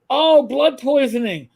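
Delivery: realizing it could have killed you; shocked realization. Retold or imitated. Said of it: "looked it up and went".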